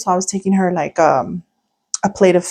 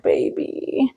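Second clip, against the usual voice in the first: breathless whisper of sympathy; whispered pity; 8 dB quieter than usual. Retold or imitated. Seeing the terrified boy walking alone, soft whisper. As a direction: whisper it, heart breaking for him.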